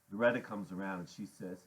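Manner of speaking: usual speaking voice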